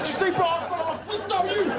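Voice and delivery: high-pitched mock drunk fighter; hammed-up drunken taunt; drunk bro. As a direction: imitate a boozed-up bro making threats. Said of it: "fight like drunk bros".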